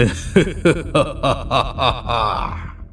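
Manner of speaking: long gloating villain's cackle; villainous laugh. Acted. Bandit chief boasting to his henchman, gloating laugh. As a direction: laugh it out in falling, drawn-out bursts.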